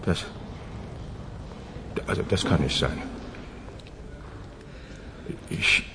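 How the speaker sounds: soft, halting, shaken; quiet stunned stammer; stunned disbelief. Acted. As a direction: barely get the words out in shock. News of the murder stuns him.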